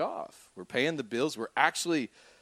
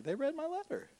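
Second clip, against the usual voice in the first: much higher, giddy and delighted; giddy joy; 5 dB quieter than usual. Retold or imitated. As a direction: squeal it with delight. Joy after bill forgiven.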